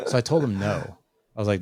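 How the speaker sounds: ordinary delivery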